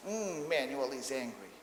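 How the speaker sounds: mock grumpy, with a long humming Mm; playfully mirror a child's anger; playful caricature. Shown as a demonstration. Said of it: "I make this very funny face that".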